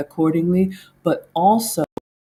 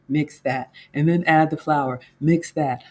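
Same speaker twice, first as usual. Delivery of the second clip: ordinary delivery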